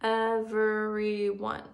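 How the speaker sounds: very slow, each syllable split apart; clapped syllables. Shown as a demonstration. Teacher clapping syllables of everyone for first graders, slow and split. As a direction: clap out every syllable slowly. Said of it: "count the syllables".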